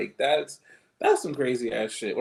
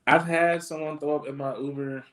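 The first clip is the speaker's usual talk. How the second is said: normal talking voice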